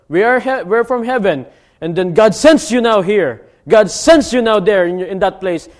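preaching at full force. Acted. Preacher reminds believers they are from heaven, fervent. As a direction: declare it to the congregation.

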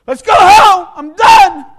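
high, loud urgent call; boy shouting to his family after nearly drowning; shaken shout. Retold or imitated. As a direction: yell to leave right now. Fleeing the beach shaken.

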